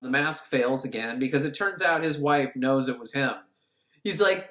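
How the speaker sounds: ordinary talk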